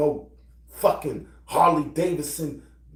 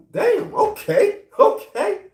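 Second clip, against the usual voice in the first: much higher, louder, slow drawn-out damn okay; amazed; 8 dB louder, slower than usual. Retold or imitated. Man stunned that a woman is trailing him, high and slow. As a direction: stretch it out in high disbelief. Mock amazement at being followed.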